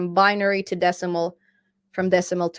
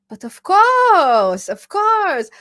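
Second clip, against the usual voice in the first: higher, louder, slow hammed-up French accent; mock French accent; 10 semitones higher, 8 dB louder, much slower than usual. Answering a viewer in a hammed-up French accent. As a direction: play a cartoon Frenchman, stretching it out. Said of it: "did you know some French words".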